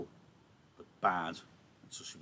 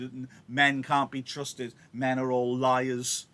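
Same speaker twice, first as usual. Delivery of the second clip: slow, louder, lightly voiced indignant nurse; paraphrased nurse; 4 semitones higher, 7 dB louder, much slower than usual. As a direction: drag out the nurse's scorn. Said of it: "So nurse joins in and says".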